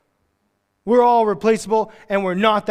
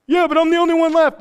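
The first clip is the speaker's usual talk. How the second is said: much higher, fast whine; whiny Elijah; 10 semitones higher, 4 dB louder, faster than usual. Retold or imitated. Elijah protesting to God, high fast whine. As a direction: protest like a sulky kid. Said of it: "It's like".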